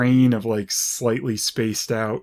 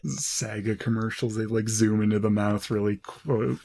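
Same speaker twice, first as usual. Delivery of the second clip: ordinary delivery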